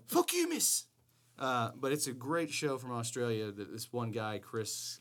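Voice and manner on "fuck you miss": character quote. Retold or imitated. Quoting show character.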